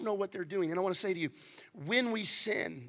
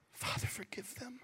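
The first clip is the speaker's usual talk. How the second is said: higher, soft whispered plea; whispered prayer; 6 semitones higher, 10 dB quieter than usual. Retold or imitated. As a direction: breathe it out gently. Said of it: "At every person".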